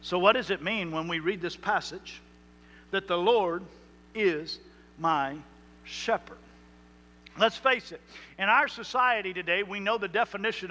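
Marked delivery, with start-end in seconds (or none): 3.1-6.4 slow, each word set apart, "shepherd" whispered. Acted. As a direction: savour every word of the verse. Slow dramatic recitation with pauses.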